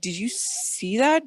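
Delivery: retold urgent check with a witness; retold disbelief. Asking brother if he saw it, incredulous.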